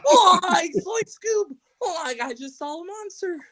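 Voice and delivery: high, yelping cartoon fright; scared Shaggy voice. Joking with his co-hosts about his eyes, in a high yelping Shaggy voice. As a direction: cry out like a spooked Shaggy.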